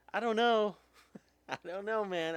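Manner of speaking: high, loud burst of disbelief; exasperated outburst. Real, not acted. Venting to viewers about abuse, a loud high outburst. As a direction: throw it up, out of words. Outrage over pedophilia news.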